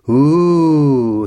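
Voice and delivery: high, loud mock-ghostly wail; spooky ghost ooh. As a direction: wave your arms and howl like a ghost. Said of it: "hissed Georgie".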